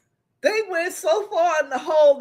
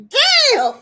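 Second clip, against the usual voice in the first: amazed damn; 13 semitones higher, 7 dB louder than usual. Real, not acted. Reacting to friend still mad.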